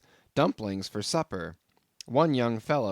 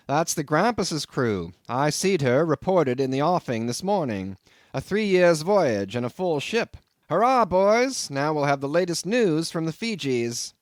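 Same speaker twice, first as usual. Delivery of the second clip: loud, higher cheering landlord; excited cry; 5 semitones higher, 6 dB louder than usual. A narrator's reading. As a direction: hail the crew coming home. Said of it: "the landlord cried".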